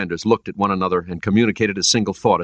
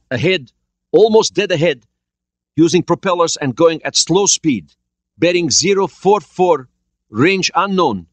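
tense sonar report; 10 semitones higher, 5 dB louder than usual. A narrator's reading. Sonar officer reports contact.

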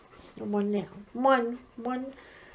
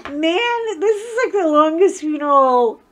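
high, pitched-up groan of complaint; exasperated whine; 6 semitones higher, 7 dB louder than usual. Retold or imitated. Complaining to viewers about the endless funeral, high exasperated whine. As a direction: whine it like you're fed up. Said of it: "I'm like".

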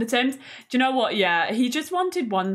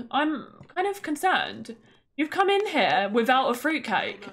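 ordinary delivery

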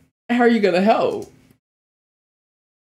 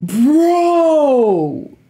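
excited shout; 10 semitones higher, 6 dB louder than usual. Real, not acted. Hyped reaction watching the fight.

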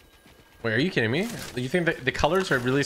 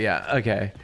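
ordinary talk

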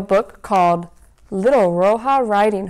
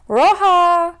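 high, loud call across the house; 9 semitones higher, 6 dB louder than usual. Acted. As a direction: Mom calls her daughter from the couch. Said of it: "called Mom from her telenovelas".

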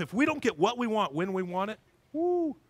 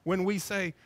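ordinary talk

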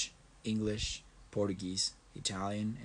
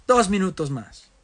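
"¡Dos minutos más!" exclamado, más fuerte y alto; exclamación; 8 semitones higher, 9 dB louder than usual. Real, not acted. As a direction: anunciarlo con énfasis alegre. Repite frase, la segunda gritada. (exclamando "¡Dos minutos más!")

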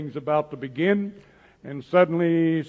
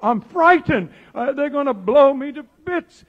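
higher, louder, mock-frightened cry; frightened Peter; 10 semitones higher, 6 dB louder than usual. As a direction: play a scared man sure he'll be blown up. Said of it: "he's just trembling all over".

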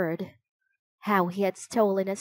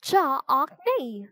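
higher, drawn-out croaking cry; raven croak; 5 semitones higher, slower than usual. A narrator's reading. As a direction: croak it slowly like a captive raven. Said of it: "the raven croaked".

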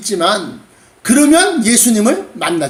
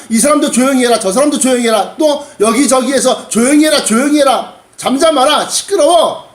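crowd scolding; 2 semitones higher than usual. Acted. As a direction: rebuke him in volleys of short orders. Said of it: "많은 사람이 꾸짖어 잠잠하라 그랬으니까". (여럿이 꾸짖는 높은 목소리)

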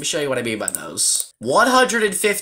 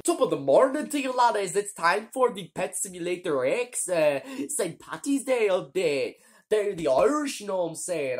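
put-on Irish accent, high-pitched; hammy stage Irish greeting; 5 semitones higher, 4 dB quieter than usual. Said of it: "my Irish accent is sh".

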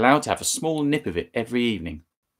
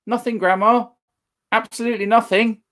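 high and loud, calling out; calling back; 12 semitones higher, 4 dB louder than usual. Acted. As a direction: innocent boy calling back. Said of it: "he called back".

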